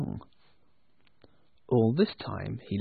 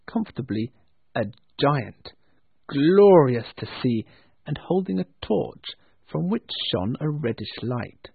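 ordinary delivery

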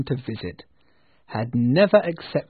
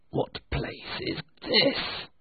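eerie whisper; 13 semitones higher, 4 dB quieter, much slower than usual. Acted. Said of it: "asked Scrooge".

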